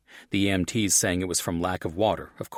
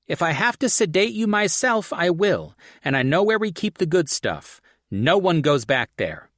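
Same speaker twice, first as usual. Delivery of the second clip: higher, louder no-nonsense nurse voice; stern nurse; 10 semitones higher, 6 dB louder than usual. Acted. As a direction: lay down the law to a big angry man. Stern ER nurse warns him.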